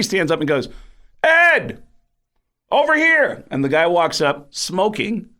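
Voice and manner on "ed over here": very high, loud shout across the alley; calling out. Retold or imitated. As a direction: wave someone over from far. Calling Eddie across bowling alley.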